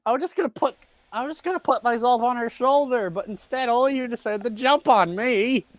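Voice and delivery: high-pitched whiny complaint; aggrieved character. Acted. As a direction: plead your case in a high voice. Mage Hand keeps complaining.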